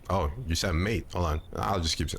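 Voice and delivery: normal talking voice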